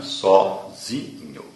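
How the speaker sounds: Sozinho drawn out very slowly, stress on the syllable zi; slow it down so zi stands out in Sozinho; stress on the second-to-last syllable. Shown as a demonstration. Teacher showing students the stressed syllable, slowly. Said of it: "aquela característica de ter o acento forte na penúltima sílaba".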